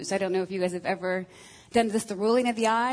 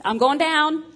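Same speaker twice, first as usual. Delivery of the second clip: "I'm going down" higher and louder, pressed out; wife signals her husband she's overwhelmed, higher and louder; emphatic keyword; 7 semitones higher, 8 dB louder than usual. Retold or imitated. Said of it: "that is my keyword".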